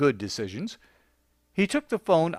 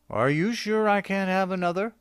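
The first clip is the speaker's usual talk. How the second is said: begging for one more drink; pleading; 6 semitones higher than usual. A narrator's reading. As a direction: plead with the bartender. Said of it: "asked the bartender in a pleading way".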